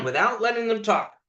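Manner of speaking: normal talking voice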